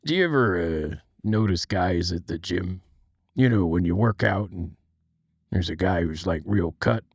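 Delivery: serious-talk Mr. Krabs impression; low, hushed Mr. Krabs being earnest; serious Mister Krabs. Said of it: "doing a bit where Mister Krabs".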